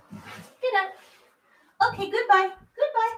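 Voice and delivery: high, playful shooing; mock-stern high scold; high pet scolding. Real, not acted. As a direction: shoo the pet from the dresses sweetly.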